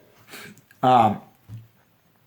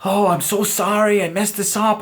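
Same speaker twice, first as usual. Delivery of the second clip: apologetic waitress; 7 semitones higher than usual. Retold or imitated. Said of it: "she's like".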